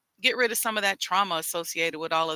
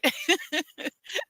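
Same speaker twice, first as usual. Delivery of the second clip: high breathy chuckle; short laugh; 8 semitones higher, 3 dB quieter than usual. Real, not acted. Host laughing at her own remark to viewers, high chuckle.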